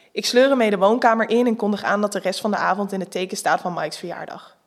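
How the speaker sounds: plain reading voice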